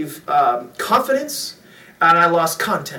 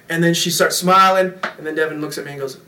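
ordinary delivery

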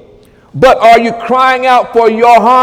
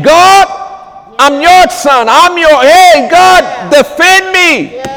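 fervent shouted prayer; 6 semitones higher, slower than usual. Real, not acted. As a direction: cry out to God for defense. Preacher calling on angels and God.

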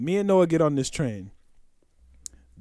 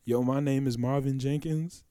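quietly stated, matter-of-fact; calm intro; 6 semitones lower, 10 dB quieter than usual. Retold or imitated. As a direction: say it softly and plainly. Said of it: "He said".

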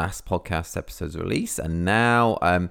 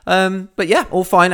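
high, louder chirpy all fine; sing-song all fine; 11 semitones higher, 5 dB louder than usual. Real, not acted. Host reassuring listeners the season is fine, sing-song. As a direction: brush it off with a bright lilt.

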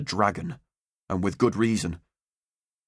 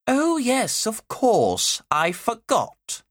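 high, slow, dripping mock innocence; acid sarcasm; 13 semitones higher, much slower than usual. Teacher mocking the boy's claim about his father, sarcastic. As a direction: pretend to remember, mocking him. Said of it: "her lips curled with acid sarcasm".

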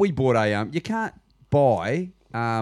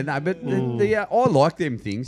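ordinary talk

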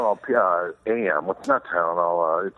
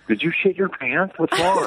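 higher-pitched imitation of an alarmed wife; mock a wife's startled, higher-voiced question; 8 semitones higher than usual. Wife's alarmed question in a retold scene.

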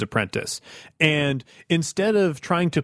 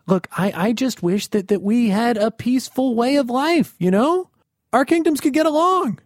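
rising higher and higher, pleading for peace; pleading peacemaker; 9 semitones higher than usual. Acted. Ruler begging the general for peace, pleading and high. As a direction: beg gently for a peaceful life.

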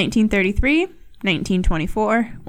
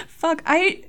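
laughing; 11 semitones higher, 4 dB louder than usual. Real, not acted. Laughing at her own flub.

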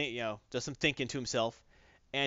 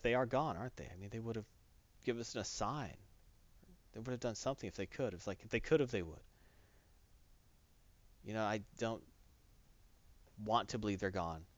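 tearful hush; 3 semitones lower, 5 dB quieter than usual. Retold or imitated. Tearful Johnny.

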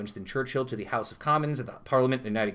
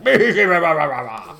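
comical Cockney; 3 semitones higher, 8 dB louder, much slower than usual. Retold or imitated. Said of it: "like Cockney".

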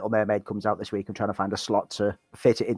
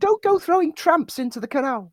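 high squeaky cat voice; chirpy cartoon cat. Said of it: "Charlie says".

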